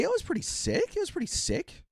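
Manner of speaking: very high, soft falsetto; falsetto squeak. Real, not acted. Streamer sums up the tournament for chat, falsetto squeak. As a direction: squeak it lightly, far above normal pitch.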